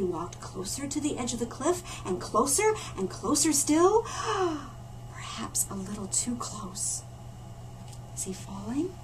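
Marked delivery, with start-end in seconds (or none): 4.1-5.1 sharp gasp. A narrator's reading. Bear nears cliff edge. 5.1-8.9 whispered, hushed and breathy; suspense whisper. A narrator's reading. Narrator to child, whispering the danger.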